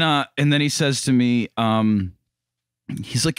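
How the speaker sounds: ordinary talk, nothing marked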